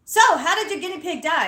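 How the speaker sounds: loud, high-pitched blurted question; really loud. Retold or imitated. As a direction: ask it obliviously at high volume. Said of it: "I'm, like, really loud".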